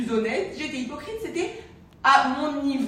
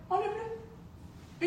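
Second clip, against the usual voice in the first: high, slow and hushed; a soft hushed instruction; 10 semitones higher, 9 dB quieter, much slower than usual. Retold or imitated. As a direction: ease it out gently, almost breathing it. Friend's advice retold softly.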